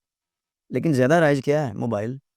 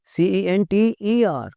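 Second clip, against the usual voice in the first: slow, letter by letter spelling; 6 semitones higher, much slower than usual. Shown as a demonstration. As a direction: spell each letter slowly and clearly. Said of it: "centre जी". (धीरे-धीरे अक्षर बोलना)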